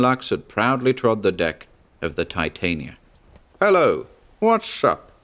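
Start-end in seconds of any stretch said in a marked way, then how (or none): 3.6-5.1 high, loud, far-carrying hail; a captain booming up from the sea; booming greeting. Acted. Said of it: "he boomed".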